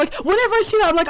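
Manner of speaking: ordinary delivery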